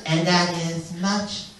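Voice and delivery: put-on slow, solemn Korean-accented wedding blessing; Korean pastor's accent. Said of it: "really interesting accent".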